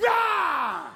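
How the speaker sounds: sharp high yelp of effort; a loud flick cry; effort shout. Acted. Flicking it like a cherry pit, a sharp yell.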